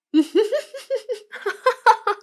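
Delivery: high, loud villainous laugh; gloating laugh. Acted. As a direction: cackle with triumph over the captive. Gloating over addicted listener.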